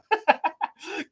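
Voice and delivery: high-pitched burst of laughter. Real, not acted. Streamer delighted with the chat's toast, high laugh.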